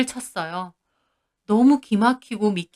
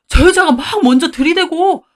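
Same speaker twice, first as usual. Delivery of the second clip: louder, higher excuse with 여자가 stressed; louder pleading husband; 7 semitones higher, 7 dB louder than usual. Acted. Husband shouting excuses at his wife, frantic. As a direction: push the blame loudly onto her. Husband blaming the woman again.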